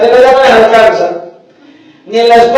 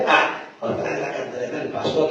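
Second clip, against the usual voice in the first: much softer, lower, fed-up mumble; mock bored complaint; 9 semitones lower, 17 dB quieter than usual. Pastor mimicking a bored member grumbling at the sermon, muttered. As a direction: sigh it out like a bored churchgoer. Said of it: "lo primero que hacen es".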